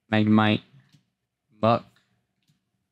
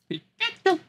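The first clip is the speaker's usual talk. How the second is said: Pikachu cry; 3 dB quieter than usual. Pretend.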